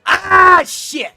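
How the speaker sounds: sudden, very high and loud yelp of dismay; a real burst of frustration, high and loud; startled yell. Real, not acted. Sudden setback in the game.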